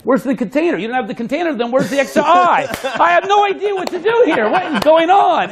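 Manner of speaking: spectator's mock panic building to a laughing high squeal; hammed-up bafflement. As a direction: play the baffled mark, rising into laughter.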